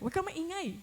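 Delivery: put-on high, firm command to a child; scolding parent. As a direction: tell the kid to hush. (mataas na utos ng magulang sa bata)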